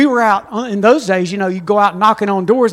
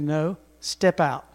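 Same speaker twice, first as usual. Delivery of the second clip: soft firm command; 6 semitones lower, 8 dB quieter, slower than usual. Acted. God's quiet command.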